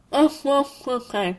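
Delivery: high, wordless mumbling through food; muffled stuffed-mouth gobbling; mouth-full noises. A narrator's reading. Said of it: "his mouth stuffed full".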